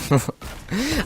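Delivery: a short, high burst of laughter. Real, not acted. Vlogger laughing at being sent home, high laugh. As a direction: squeal out a quick laugh.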